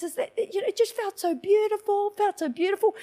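high, lightly tearful mock voice; imitated crying. Woman imitates her mother moved by God's love, tearful. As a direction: imitate someone overcome and weepy.

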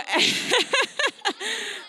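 a long high laugh. Real, not acted. Preacher laughing mid-story about a reckless driver, high laugh. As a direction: laughs at losing the thread.

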